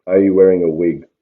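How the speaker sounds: normal talking voice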